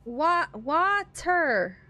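"water" very slow, restarted and split in two; struggling to pronounce. Shown as a demonstration. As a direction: labour over "water", drawing out each syllable. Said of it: "I can't say water anymore".

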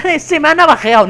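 high, loud, rushed mock complaint; mock tattletale. Imagined victim complaining to the police, mock tattletale. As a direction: whine like a snitch reporting to the police. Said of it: "no puedes ir a la policía en plan".

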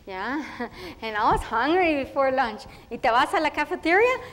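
eager chatty schoolgirl voice, Spanish mixed in; a proud first-grader telling her day in a rush; little girl Tere. Acted. Girl telling about her first school day, eager and chatty.

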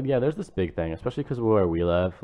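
ordinary delivery

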